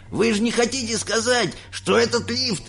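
high, incredulous outcry at normal volume; cried out. A narrator's reading. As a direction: protest in a high, disbelieving voice. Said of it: "закричал дедушка Джо". (высокий недоверчивый выкрик)

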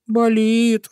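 Болит high, long and pitiful; plaintive. A narrator's reading. Hurt little hare answers his friend, plaintive. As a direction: whimper Болит on a held high note. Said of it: "жалобно ответил зайка Петя".

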